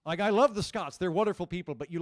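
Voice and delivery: ordinary delivery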